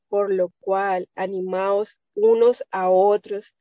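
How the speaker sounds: slow, deliberate reading of the verse; measured, unhurried verse recital; slow scripture quote. Acted. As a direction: lay each word down slowly like reading from the Bible. Quoting scripture to the sisters.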